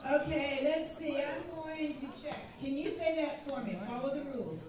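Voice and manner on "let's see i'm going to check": usual speaking voice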